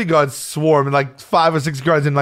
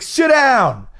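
high heckler's yell; mock-yell it from rows behind; imitated yell; 8 semitones higher than usual. Guy behind him at concert, imitated yell. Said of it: "people start yelling at us".

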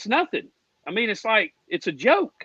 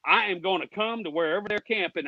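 ordinary talk, nothing marked

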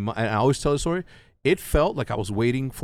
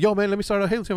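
higher, eager retold request; eager pitch; 9 semitones higher, faster than usual. Friend asking to start a Halo team, eager. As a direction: pitch it like a keen buddy.

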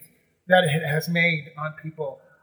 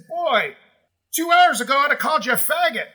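gruff stranger; 8 semitones higher, 4 dB louder than usual. Retold or imitated. Man's confession in the lobby.

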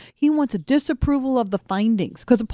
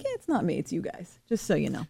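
quiet aside; 3 semitones lower, 9 dB quieter than usual. Retold or imitated. Retold aside to the others.

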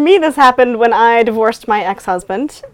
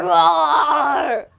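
shouted dinosaur roar; one more dinosaur roar; 5 semitones higher than usual. Shown as a demonstration. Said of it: "roar like a dinosaur".

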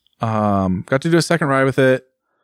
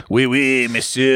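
mock French accent; slower than usual. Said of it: "I should know how to do a French accent".

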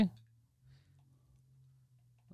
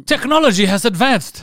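hammed-up Chinese accent, pitched higher; mock Chinese accent; 4 semitones higher than usual. Comics voicing Chinese parents boasting of progress, mock Chinese accent.

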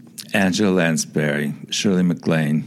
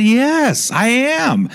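high, loud, gleeful yes; excited; 5 dB louder, much slower than usual. Retold or imitated. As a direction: burst out with delight. Star delighted about meeting friends.

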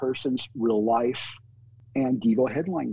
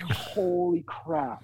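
stunned; 4 semitones higher, 3 dB quieter, much slower than usual. Retold or imitated. Stunned reaction to the agent reveal.

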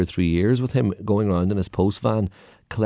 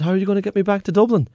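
put-on high, quick worried old man; anxious stowaway; 12 semitones higher, faster than usual. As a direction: press for an answer. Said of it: "he said".

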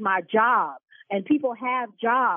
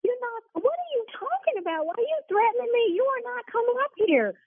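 outraged high-pitched protest; 12 semitones higher than usual. Real, not acted. Shop owner protesting a caller's threat, high and outraged. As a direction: pitch shoots way up in disbelief and anger.

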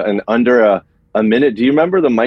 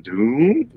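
drawn-out "doom" gliding up high; vocal mimic of a bass drum bend; imitated drum tone; 5 semitones higher than usual. Shown as a demonstration. Musician showing the bass drum's tones, sliding hum.

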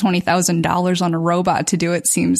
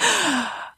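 sharp startled gasp; mock gasp; 9 semitones higher, 6 dB quieter than usual. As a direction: gasp in alarm. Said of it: "And I'm like".

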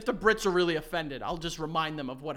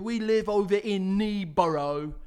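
mock British accent; 3 semitones higher, 5 dB louder, slower than usual. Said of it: "England-sounding places".

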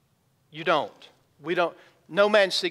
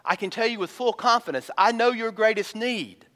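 usual speaking voice

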